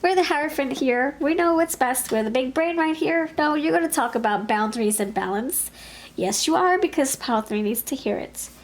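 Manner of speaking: mock-insistent guide laying down the law; insistent Spirit. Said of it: "And Spirit's like".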